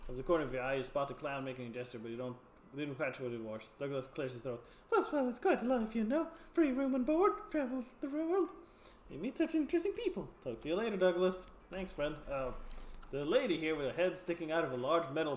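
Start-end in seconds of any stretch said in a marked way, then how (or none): 4.9-10.3 high, cheerful freak voice with a laugh; two-faced sideshow freak. Acted. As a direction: play the odd two-faced performer, amused. Freak praises sideshow life.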